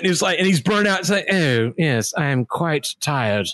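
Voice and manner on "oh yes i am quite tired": weary, low English burnout cop; English accent. Acted. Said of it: "English accent".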